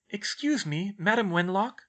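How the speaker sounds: tentative. A narrator's reading. Schoolgirl addresses a portrait, tentatively. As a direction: get their attention tentatively, voice lifted. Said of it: "Hermione said tentatively".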